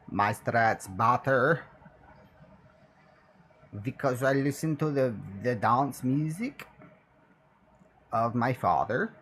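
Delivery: slow mock European accent; hammy slow Dutch-ish accent. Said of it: "speaking in a European accent now".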